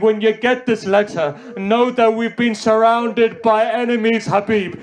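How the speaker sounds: high, loud grieving preacher's cry; impassioned recitation. Acted. Reciter retelling Hussein's urgent letter, loud impassioned lament. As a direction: lament it to the mourners at full force.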